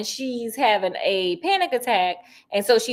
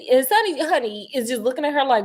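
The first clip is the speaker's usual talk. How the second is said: normal talking voice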